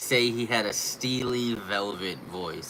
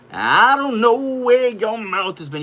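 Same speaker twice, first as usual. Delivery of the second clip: loud, high-pitched hammed-up yell; suddenly belted out, much higher; mock outburst; 11 semitones higher, 13 dB louder than usual. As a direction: holler it in mock protest at the dog. Exaggerated repeat to the dog.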